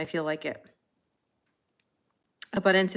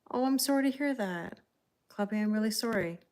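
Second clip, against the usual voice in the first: softer, tender condolence; sympathetic; 3 semitones higher, 4 dB quieter than usual. Real, not acted. Reader consoling a sad viewer, gently sympathetic.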